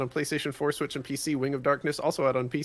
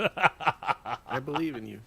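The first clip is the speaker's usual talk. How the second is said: laughing; 4 dB louder, much slower than usual. Real, not acted. Laughing encouragement.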